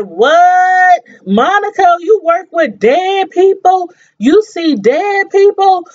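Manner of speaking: high, drawn-out incredulous questioning; shocked disbelief. Acted. Reacting to Monica being a mortician, shocked disbelief. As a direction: stretch each question up high in mock shock.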